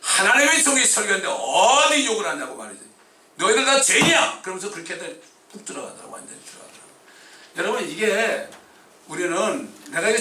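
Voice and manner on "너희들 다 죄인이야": loud, high outburst; yelling. Retold or imitated. Pastor yells at cursing passengers, loud high outburst. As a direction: shout it back at the crowd. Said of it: "소리를 버럭 질렀죠".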